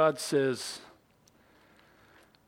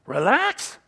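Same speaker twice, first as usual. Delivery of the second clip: loud, higher, disbelieving; incredulous scoff; 6 semitones higher, 6 dB louder than usual. Acted. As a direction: throw the word out in disbelief. Scoffs at relaxing as un-American.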